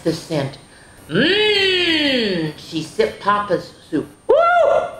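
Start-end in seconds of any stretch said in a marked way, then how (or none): 1.1-2.5 high, long falling mmm; savouring mm. Acted. Girl sniffing the soup, long savouring mmm. 4.3-4.9 very high, loud woo; startled whoop. Acted. Girl tasting Papa's hot soup, startled whoop.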